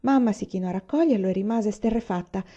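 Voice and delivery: usual speaking voice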